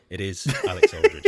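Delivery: announcer intro. Acted. Host announcing co-host name.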